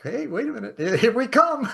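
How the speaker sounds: high, laughing mock rallying call; cheeky troublemakers announcing their arrival; playful bold announcement. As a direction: pitch jumps up, breaking into laughter. Voicing bold disruptors, laughing.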